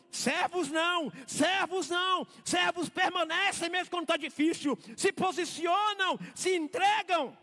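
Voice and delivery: high, loud, slow hammered sermon; full-force preaching. Acted. Pastor to congregation on servants, fervent full-force preaching. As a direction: preach it out, slowing on each last phrase.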